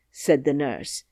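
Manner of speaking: plain reading voice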